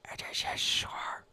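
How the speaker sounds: whispered Dutch accent, slowed; old Dutch sailor, whispering, slow. Shown as a demonstration. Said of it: "now I must say it with the best Dutch accent I can".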